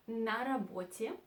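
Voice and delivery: ordinary delivery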